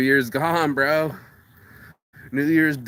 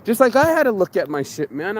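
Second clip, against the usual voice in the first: usual speaking voice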